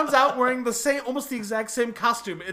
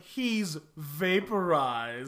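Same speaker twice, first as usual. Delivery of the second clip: slow deadpan; 6 semitones lower, 5 dB quieter, much slower than usual. Retold or imitated. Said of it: "William Forsythe is like".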